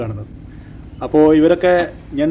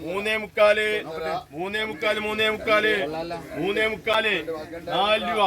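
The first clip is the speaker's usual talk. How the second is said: higher repeated bid calls; auctioneer; 4 semitones higher, 10 dB quieter than usual. Real, not acted. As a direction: keep calling the rising bid. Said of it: "ഈ ലേലം വിളിക്കുന്ന ആള്".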